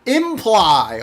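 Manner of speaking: "Imply" loud and high, falling; emphatic self-correction. Real, not acted. Streamer fixing a misspoken word, "Imply" loud and exasperated. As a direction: blurt the corrected "Imply", drawn out and forceful.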